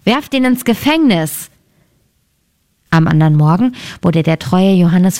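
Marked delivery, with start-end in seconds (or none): 0.0-1.5 angry king's order. Acted. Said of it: "wurde zornig darüber und rief".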